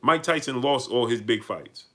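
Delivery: normal talking voice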